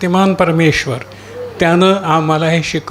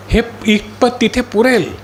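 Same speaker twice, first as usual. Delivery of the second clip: ordinary talk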